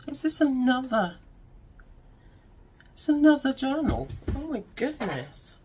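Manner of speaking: gasping, high and loud; thrilled gasp at a surprise; breathless excitement. Real, not acted. Finds another journal in box.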